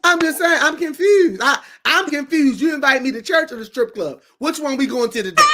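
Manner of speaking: high, loud comic bewilderment; mock confusion. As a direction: protest loudly in hammed-up confusion, pitched high. Mock confusion at church-or-club invite.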